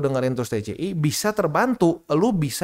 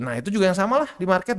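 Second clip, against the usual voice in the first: ordinary delivery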